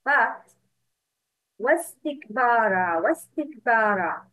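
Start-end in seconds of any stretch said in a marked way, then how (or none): 1.6-4.2 "wastikbara" run together, no hamza after "wa"; silent hamza. Shown as a demonstration. Teacher tests whether students hear hamza, left silent in "wastikbara". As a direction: glide from "wa" straight into "stikbara", slowly. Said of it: "it's a hamza wasl".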